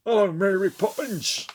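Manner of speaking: high, loud mock Cockney; hammed-up bad Cockney accent, called out. Film fan mocking a Mary Poppins actor, bad Cockney accent.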